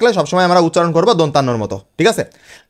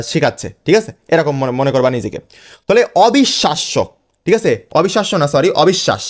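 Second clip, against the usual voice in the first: ordinary talk, nothing marked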